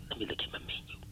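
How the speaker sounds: whispered to himself over the menu; mock whisper. A diner mutters in a whisper over the menu. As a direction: breathe it, no voice.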